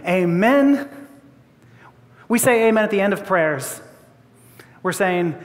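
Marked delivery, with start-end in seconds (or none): none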